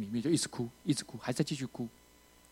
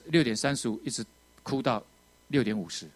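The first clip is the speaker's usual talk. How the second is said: ordinary talk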